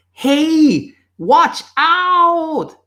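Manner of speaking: very high, drawn-out yell to a friend. Acted. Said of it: "So you shout even louder".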